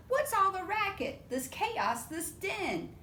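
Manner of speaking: a hint of an angry mom; stern mama. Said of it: "She comes storming right in".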